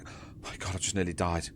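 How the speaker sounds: hushed, half-whispered relief; sneaking away. Acted. Petrified guard tiptoeing away, hushed whisper. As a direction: mutter it under the breath while creeping off. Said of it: "sneaking away like".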